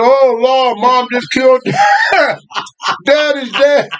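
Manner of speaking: high-pitched comic dismay; hammed-up child's wail, breaking into laughter; mock alarmed cry. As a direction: cry out in exaggerated shock. Children think mother shot dad.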